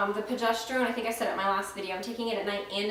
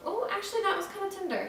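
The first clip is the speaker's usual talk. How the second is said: high, startled realization; sudden surprise; 10 semitones higher, 3 dB quieter, faster than usual. Real, not acted. Vlogger suddenly noticing her own tenderness. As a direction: catch yourself mid-sentence, pitch jumping up. Finds soreness while checking.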